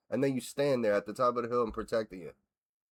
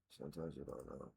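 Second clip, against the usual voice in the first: barely audible, much softer repeat; under his breath; 11 semitones lower, 21 dB quieter than usual. Real, not acted. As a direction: echo the thought almost inaudibly.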